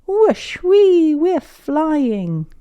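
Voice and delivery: high, loud gleeful wee; fly up with glee; joyful whoosh. Acted. Kids riding narwhals, high gleeful cries.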